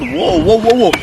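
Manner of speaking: retold alarm. Retold alarm at man pulling something.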